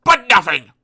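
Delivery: loud, gruff Mister Grumpy; grumpy shout. Acted. Mister Grumpy refusing Mister Happy, loud and cross. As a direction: bark it like a cross old grouch.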